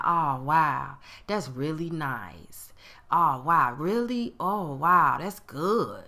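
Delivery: low, slow and flat, faking that it's nice; flat fake enthusiasm. Imitating a jealous friend answering good news flatly. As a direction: act pleased while clearly not meaning it. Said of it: "That tone did not sound like".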